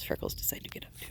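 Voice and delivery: fast, hushed whisper; quick whisper. Real, not acted. She whispers to the camera as Freckles wakes up. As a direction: breathe it out quickly so the sheep aren't disturbed. Quiet aside about waking sheep.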